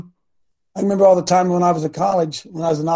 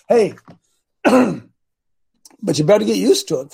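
ordinary talk

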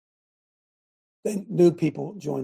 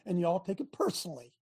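ordinary talk

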